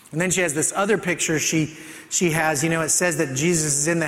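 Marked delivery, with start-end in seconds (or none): none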